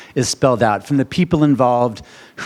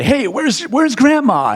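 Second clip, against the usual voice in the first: cheery oblivious; 10 semitones higher, slower than usual. Pretend. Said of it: "I don't want to say".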